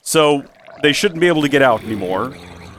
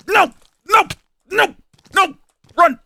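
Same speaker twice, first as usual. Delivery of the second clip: yelling while running away; 3 dB louder than usual. Real, not acted. Fleeing mobs, yelling.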